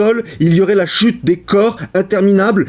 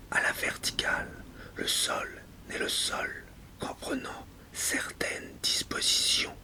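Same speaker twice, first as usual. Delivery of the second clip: hushed whisper with long pauses; whispered poem; 6 semitones higher, 21 dB quieter than usual. Acted. Speaker privately thankful for the ground, whispered. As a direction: whisper it, phrase by phrase, leaving silences.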